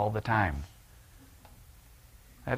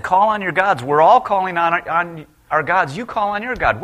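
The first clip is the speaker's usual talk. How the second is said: higher, louder panicked cries; frantic sailors shouting; 5 semitones higher, 8 dB louder than usual. Acted. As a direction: yell like sailors in a storm. Panicked sailors on sinking ship.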